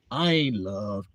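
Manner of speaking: low, subdued mock despair; unloved self-pity. Doubting man answering the warning, low self-pity. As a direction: sink low, acting out feeling unloved.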